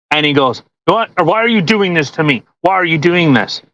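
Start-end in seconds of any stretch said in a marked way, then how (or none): none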